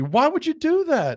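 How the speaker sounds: high, drawn-out disbelief; incredulous. Real, not acted. Host reacting to missed flights, incredulous. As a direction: stretch the question out in bafflement.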